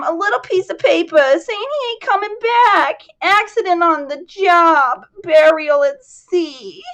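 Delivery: put-on high, loud, grieving old woman; a widow from South Jersey wailing her loss; distraught old widow. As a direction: push it out through the grief. Widow tells of husband's death.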